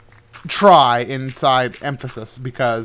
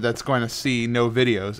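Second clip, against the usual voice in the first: normal talking voice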